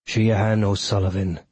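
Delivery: sneering villain, voiced apart from the narration; understated sneer in a character voice. A narrator's reading. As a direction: sneer the name at an enemy. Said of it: "he sneered".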